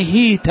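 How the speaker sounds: هيت said as hīta, h with kasra; هيت with a long i after the h; Quranic reading hita. Shown as a demonstration. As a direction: read هيت as hee-ta. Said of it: "بكسر الهاء وفتح التاء".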